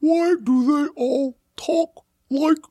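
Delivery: high, very slow, strained Klingon parody; mask-choked Klingon. Retold or imitated. Mocking how the new Klingons talk, mask-choked Klingon voice. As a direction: labour out each word as if choking on a mouthful. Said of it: "talking like they're choking on a mouthful of pudding".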